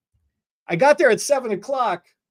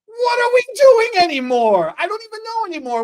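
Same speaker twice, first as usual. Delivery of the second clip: high, loud, fed-up cry; exasperated outburst; 11 semitones higher, 4 dB louder than usual. Real, not acted. Stylist venting about her day, loud and fed up. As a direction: throw up your hands in despair.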